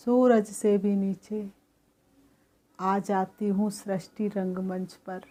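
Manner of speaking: calm, even meditation guidance; guided meditation. Acted. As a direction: lead the soul gently inward and back. Leads a guided soul meditation.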